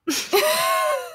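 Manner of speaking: high squealing burst of laughter; shrieking laugh. Real, not acted. Reacting to friend's "No.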